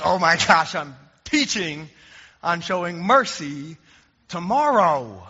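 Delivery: a sheepish "oh no" moment; sudden realization. Retold or imitated. Pastor realizing his own hypocrisy before preaching on mercy, sheepish. Catches himself mid-argument, laughing.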